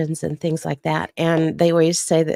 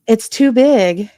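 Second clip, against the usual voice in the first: mock dismay; 3 semitones higher, 6 dB louder, slower than usual. Dramatic repeat of complaint.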